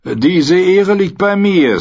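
high, slow, loud mock-courtly reply; oily mercenary captain. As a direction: savour the mock politeness. Dürrer Anführer antwortet. (schmierig-höflicher Anführer)

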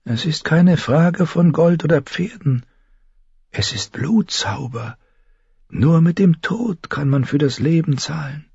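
high, grave character voice; ominous witch-healer. A narrator's reading. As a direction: spell out the terrible price.

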